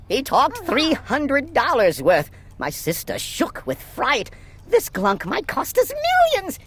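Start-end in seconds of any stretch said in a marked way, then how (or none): none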